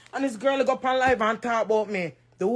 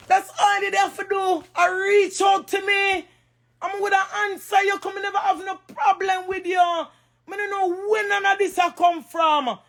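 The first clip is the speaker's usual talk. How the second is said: upset; 6 semitones higher than usual. Real, not acted. Woman protesting to the girl who went live, upset. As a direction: raise your voice, really upset. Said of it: "me dey upset".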